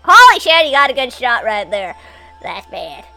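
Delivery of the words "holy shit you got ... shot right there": ordinary talk, nothing marked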